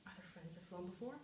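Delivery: low, soft mutter to oneself. A narrator's reading. Narrator to himself as the raven sits silent, low muttering. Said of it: "scarcely more than muttered".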